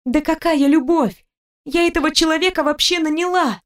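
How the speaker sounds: high, emphatic female character voice; indignant denial. A narrator's reading. Wife protesting to her jealous husband, indignant denial. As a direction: deny it high, exasperated.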